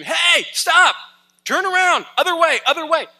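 high-pitched, full-force shout; urgent warning scream. Acted. Warning a texting walker about an oncoming truck, urgent scream. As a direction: scream to halt someone walking into danger. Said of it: "And then I scream".

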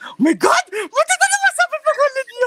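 loud, very high-pitched shriek of shock; real alarmed outburst. Real, not acted. Speaker nearly blurts a name to a friend, real alarmed shout. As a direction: catch yourself with a startled high shout.

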